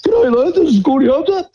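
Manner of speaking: mock the coach calling out of the blue; Gordy Hunter. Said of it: "and he goes".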